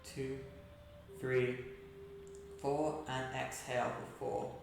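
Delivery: soft, unhurried breathing-exercise voice; guided meditation. Acted. Yoga teacher guiding seated class through breathing and gratitude, soft meditation voice. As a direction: count the breaths softly, leaving long pauses.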